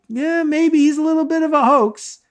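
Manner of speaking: high, wry sarcastic concession; sarcastic aside. Pretend. Watching the movie, a high sarcastic aside. Mocking aside about the character.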